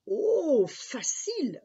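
high, loud gleeful "oh"; gleeful boy. Acted. Jacques finds the old man's riddle easy, gleeful and high. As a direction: crow it as if guffawing. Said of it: "répondit Jacques en s'esclaffant".

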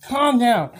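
usual speaking voice

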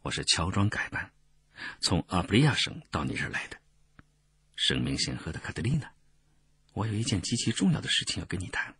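soft, discreet murmur; low-voiced reply. A narrator's reading. Disguised messenger asking the noble for a talk, hushed. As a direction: confide it without being overheard. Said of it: "低声回答".

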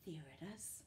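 hushed reveal of the hidden ball; whisper. Acted. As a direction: whisper it like sharing a find.

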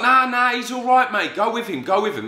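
high-pitched, insistent urging; imitated brush-off. Dad telling son to go with him, dismissive urging. Dad waving away his objection.

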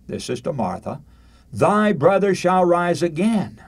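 ordinary talk

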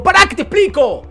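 high, loud protest; pleading shout. Retold or imitated. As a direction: cry out to be let explain. Son pleads after being shaken.

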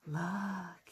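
ordinary delivery

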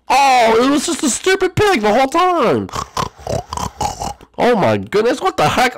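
very high, loud outburst; exasperated disbelief. Pretend. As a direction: wail it out, utterly incredulous. Outburst at the stupid pig reveal.

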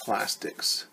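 whispered to self. Real, not acted. Writing word down.

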